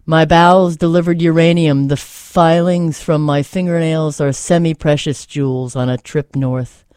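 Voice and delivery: steady, earnest recital with weight on each line; poem reading. Acted. Host reading a Nikki Giovanni poem to listeners, earnest recital. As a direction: read it as a poem you believe in.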